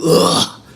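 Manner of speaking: loud effortful grunt. Shown as a demonstration. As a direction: heave out a throaty "ugh". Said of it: "I did [name] voice".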